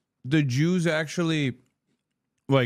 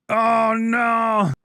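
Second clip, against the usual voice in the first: mock panic, shouted high; panicked shout; 7 semitones higher, 4 dB louder than usual. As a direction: yelp in fake alarm. Mock panic at French pursuit.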